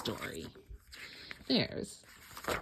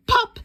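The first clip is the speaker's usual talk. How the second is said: sharp, very high, loud pop; popping sound; 10 dB louder than usual. A narrator's reading. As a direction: make the button burst off. Button pops off shirt.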